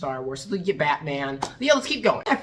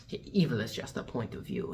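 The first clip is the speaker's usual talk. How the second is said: low, soft villain imitation; Cardinal Richelieu; 9 semitones lower, 8 dB quieter than usual. Villain answering that he is evil, low hushed voice. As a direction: say it quietly, unbothered. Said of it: "and he goes".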